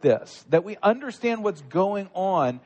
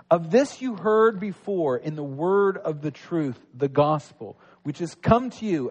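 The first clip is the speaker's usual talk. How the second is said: lower, steady reading of the Bible passage; scripture reading; 4 semitones lower than usual. Acted. Pastor reading Colossians to the congregation, measured scripture reading. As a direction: read the verses out like a formal lesson.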